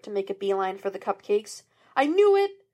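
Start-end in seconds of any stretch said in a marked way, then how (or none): 2.0-2.6 loud, high burst of triumph; triumphant shout. A narrator's reading. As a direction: yell it like you caught him out. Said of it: "Sean shouted".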